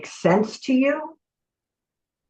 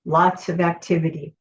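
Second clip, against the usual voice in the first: long calm pauses, gentle guidance; guided meditation. Acted. Leads a guided visualization.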